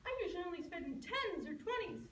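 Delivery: very high, put-on girlish voice with giggles; innocent little girl. Red innocently turns down the wolf's idea, girlish and very high. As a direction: play wide-eyed innocence way up high. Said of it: "blinked her big blue eyes innocently".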